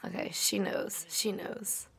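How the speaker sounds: very soft, half-whispered; quiet near-whisper to yourself; hushed aside. Real, not acted. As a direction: say it under your breath. Muttered relief while watching.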